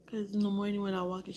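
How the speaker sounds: ordinary talk, nothing marked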